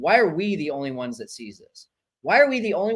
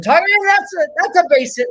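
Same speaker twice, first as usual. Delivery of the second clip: high, loud burst of cheering; excited shout; 13 semitones higher, 8 dB louder, faster than usual. Real, not acted. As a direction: yell it out as the ball drops in. Calling a base hit live.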